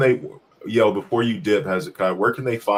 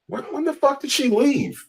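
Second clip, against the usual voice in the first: surprised. Real, not acted. Wife texting, surprised outburst.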